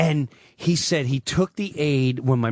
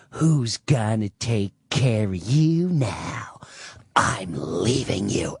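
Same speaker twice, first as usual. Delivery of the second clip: mother's taunt; 5 semitones lower, slower than usual. Retold or imitated. Mother taunting father at breakfast.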